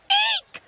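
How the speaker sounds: piercing cartoon eek; short terrified bird shriek; high shriek. A narrator's reading. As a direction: squeal in fright, very high and loud. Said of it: "cried Cookie".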